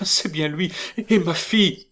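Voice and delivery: high, emotional outburst; a mother's sudden high cry; excited cry. A narrator's reading. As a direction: exclaim it with rising emotion. Mother exclaims over news of daughter.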